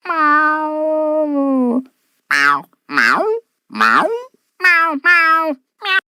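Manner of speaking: snail meowing. Acted. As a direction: meow like a little pet snail. Pet snail meows.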